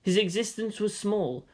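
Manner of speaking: ordinary delivery, nothing marked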